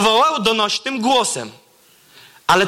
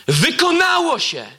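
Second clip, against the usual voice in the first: high anguished cry; 9 semitones higher than usual. Acted. Preacher acting Jesus's last words as a cry. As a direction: cry out Wykonało się as a last breath. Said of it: "ostatnie jego słowa brzmią tak".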